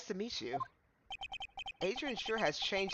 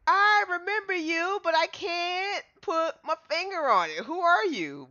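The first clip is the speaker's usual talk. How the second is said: higher, louder, slow, racking the memory; straining recognition; 12 semitones higher, 6 dB louder, slower than usual. Acted. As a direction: reach for a name that won't come. Straining to remember.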